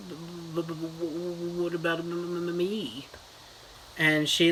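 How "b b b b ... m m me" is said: imitated stutter. Billy shyly asks Beverly about himself, mock stuttering. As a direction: stumble over each starting consonant on a held note. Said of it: "stuttering Billy, he's like".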